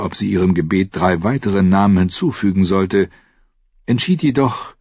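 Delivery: ordinary delivery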